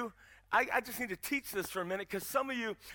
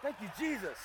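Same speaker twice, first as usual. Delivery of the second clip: softer, meek mock-pious reply; meek prayer; 3 semitones lower, 6 dB quieter than usual. Young man praising after being yelled at, meek and softer. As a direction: drop to a small, obedient praise.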